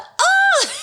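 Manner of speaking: high piercing mock scream; imitated scream. Retelling how her brother screamed in the haunted house, high shriek. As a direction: shriek it like a startled boy. [name] does the.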